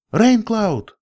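high, loud call across a cliff; shouting a name. Acted. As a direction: call out his name sharply. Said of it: "крикнула я".

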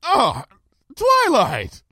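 high, jovial call; shouted back. A narrator's reading. Princess greets her friend up on the tower, cheery shout back. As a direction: hail a friend from far off. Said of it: "Luna shouted back".

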